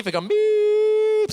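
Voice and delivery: high, long held electronic beep; breathalyzer beep. Retold or imitated. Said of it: "Et le truc commence à crier".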